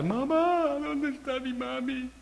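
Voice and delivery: put-on high, small plea for mami, voice gone childlike; whimpering like a child. Preacher mocks the tough man calling for his mami, childlike whimper. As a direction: beg for your mommy like a lost little kid. Mocking the brave man's end.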